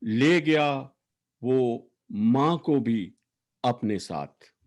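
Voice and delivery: emphatic, declaimed verse, line endings falling away; poetry recitation. Acted. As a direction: recite an elegy with weighted words and long pauses. Recites own poem for late sister.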